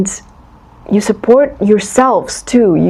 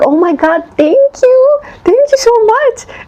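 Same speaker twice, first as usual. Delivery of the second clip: gushing thanks; 13 semitones higher than usual. Retold or imitated. Listener thanking Spirit for something small, gushing high. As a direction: squeal your thanks for a tiny gift. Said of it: "you're like".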